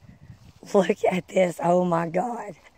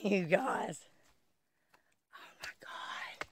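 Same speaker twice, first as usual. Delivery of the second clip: breathless whisper of real awe, much softer; awed whisper; 18 dB quieter, much slower than usual. Real, not acted. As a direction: hush it like you're in a sacred place. Awed by ancient complex.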